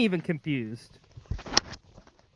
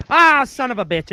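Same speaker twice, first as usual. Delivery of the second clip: loud, delighted exclamation; excited outburst; 7 semitones higher, 6 dB louder than usual. Real, not acted. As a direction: cry out at the reveal. Mask reveal realization.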